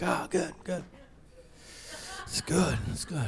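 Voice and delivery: very soft, guilty approval; acted-out quiet, uneasy relief; sheepish murmur. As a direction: say it small, hiding something. Guilty father answers wife.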